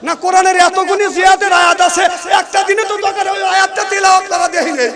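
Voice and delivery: high, loud, hurried rebuke; shouted complaint. Real, not acted. Preacher complaining of Quran verses never recited, shouted. Scolds crowd about missing recitation.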